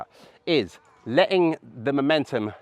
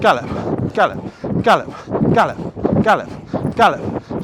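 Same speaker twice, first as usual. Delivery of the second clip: calling the moves; 6 semitones higher, 6 dB louder than usual. Real, not acted. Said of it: "I'm gonna break into a gallop".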